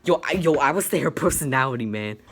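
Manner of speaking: mock bro answer. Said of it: "He was like".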